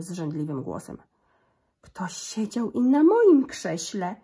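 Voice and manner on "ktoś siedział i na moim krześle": slow, louder mama bear with a rise; cieńszy głosik. A narrator's reading. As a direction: call out slowly and loudly, lifting the end. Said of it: "cieńszym głosikiem". (mama niedźwiedzica woła wolno i głośniej)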